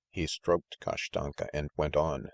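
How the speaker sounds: ordinary delivery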